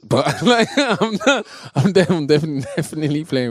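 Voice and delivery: laughing while talking; words broken by laughter. Real, not acted. Laughing at own joke about God.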